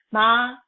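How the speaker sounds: MA held high and level, loud; first tone. Shown as a demonstration. Teacher models tones for class, first tone. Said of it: "four basic tones with example".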